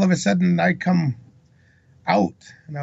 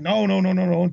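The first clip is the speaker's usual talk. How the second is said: higher, rattled-off string of refusals; urgent warning; 4 semitones higher than usual. Retold or imitated. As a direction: cut him off before he fires. Retold urgent warning against shooting.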